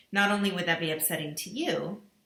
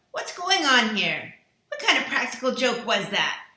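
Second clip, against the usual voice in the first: spluttering; 4 semitones higher, 6 dB louder than usual. A narrator's reading. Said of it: "General MacArthur spluttered out".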